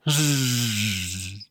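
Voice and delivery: quiet snoring breath; a dog's soft sleepy snore. Acted.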